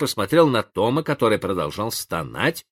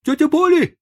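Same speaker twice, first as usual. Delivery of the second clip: very high, loud breathless call; shouting out of breath; 7 dB louder than usual. A narrator's reading. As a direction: yell for her, panting. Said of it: "закричал он, запыхавшись".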